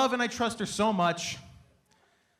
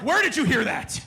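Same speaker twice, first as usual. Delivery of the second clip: mock anger; 3 semitones higher, 5 dB louder than usual. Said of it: "I was mad".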